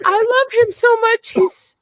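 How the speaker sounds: loud, high gush of tearful love; tearfully emotional. Real, not acted. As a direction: pour it out, high and overwhelmed. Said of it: "gonna cry".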